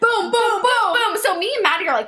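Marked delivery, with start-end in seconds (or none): 0.3-1.2 punch sounds. Retold or imitated. Mimicking punches.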